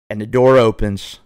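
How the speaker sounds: ordinary delivery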